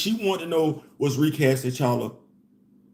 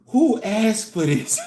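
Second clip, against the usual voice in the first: mock incredulous; 5 semitones higher, slower than usual. Quoting friend's mocking question.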